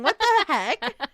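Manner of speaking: high, loud, slow indignant what the heck; mock outrage. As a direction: bristle at it, half laughing. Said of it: "And I was like".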